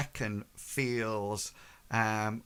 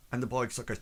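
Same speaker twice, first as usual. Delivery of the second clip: ordinary talk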